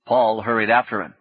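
ordinary delivery

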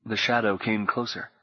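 ordinary delivery

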